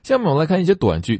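ordinary talk, nothing marked

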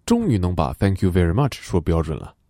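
ordinary talk, nothing marked

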